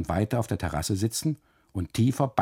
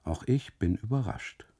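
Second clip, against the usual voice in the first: usual speaking voice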